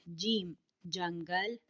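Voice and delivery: slow, deliberate reading of جیم and جنگل as a model. Shown as a demonstration. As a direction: say جیم and جنگل slowly and clearly for pupils to repeat. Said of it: "گوش کنید و بخوانید".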